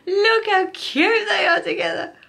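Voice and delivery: high, loud, slow squealing; overwhelmed by cuteness. Real, not acted. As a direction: squeal over something adorable. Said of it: "should make you make those noises".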